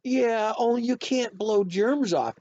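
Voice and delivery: put-on high, loud kid correcting mom; child setting a parent straight; know-it-all objection. A narrator's reading.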